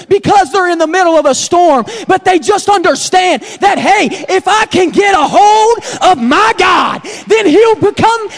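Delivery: loud, high, fervent pulpit shout; shouted preaching climax. Acted. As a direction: lift the church to its feet.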